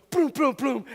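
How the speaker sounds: high, punchy boom boom boom; fall sound. Retold or imitated. Dad imitating his daughter falling down the steps. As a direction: mimic her tumbling down the steps.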